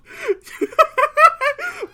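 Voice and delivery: loud high laugh; high burst of laughter. Real, not acted. Laughs at the character.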